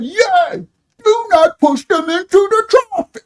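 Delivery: very high mock yell; mocking impression. Mocking the man yelling at the cop, high exaggerated yell. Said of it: "uh, mock".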